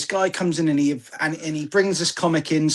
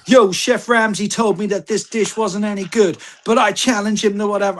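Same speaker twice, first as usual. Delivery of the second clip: higher-pitched mock reality-show contestant; American show contestant; 4 semitones higher than usual. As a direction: parody a scripted cooking-show contestant. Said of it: "the way they're like".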